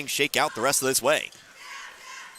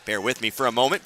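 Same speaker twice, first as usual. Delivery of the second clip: ordinary talk, nothing marked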